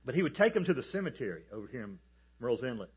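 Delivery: ordinary talk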